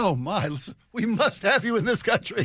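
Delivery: a light mock British accent, pitched high; playful British accent impression. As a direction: play the charmed British gent, voice lifted high. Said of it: "He is good at this".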